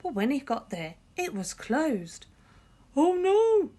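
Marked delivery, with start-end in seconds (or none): 3.0-3.7 much higher, loud cry; dismayed cry. A narrator's reading. George cries out finding the shop closed. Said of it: "cried George".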